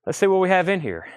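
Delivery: breathless, panting between words; out of breath. Real, not acted. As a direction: talk while winded. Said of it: "I'm out of breath".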